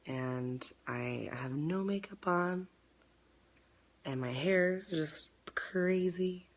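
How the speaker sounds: hushed whisper, almost no voice. Real, not acted. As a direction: whisper it like a confession. Confiding about no makeup.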